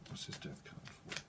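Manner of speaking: breathy whisper, asking the cards; whispered aside. Real, not acted. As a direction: whisper the question to yourself.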